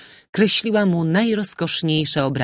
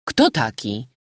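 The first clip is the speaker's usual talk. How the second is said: exclaimed question; 4 semitones lower than usual. A narrator's reading. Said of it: "wykrzyknął hiszpański kapitan".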